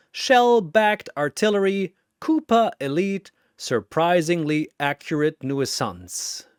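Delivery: usual speaking voice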